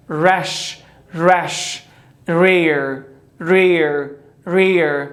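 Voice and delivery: each word starting with a round English R, said three times; English R drill words. Shown as a demonstration. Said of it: "لغت دوم رپ". (تمرین کلمات با آر گرد انگلیسی)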